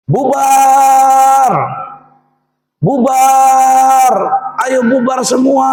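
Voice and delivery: loud, high shout with the last syllable held long. Retold or imitated. New kiai to the congregation, shouting. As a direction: bellow it out to clear the whole place. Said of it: "dia teriak".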